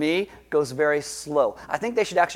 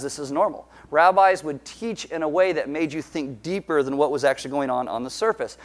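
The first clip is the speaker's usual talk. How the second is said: normal talking voice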